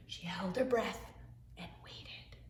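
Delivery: whispered, holding the breath; hushed storybook suspense. A narrator's reading. Suspense before the jump.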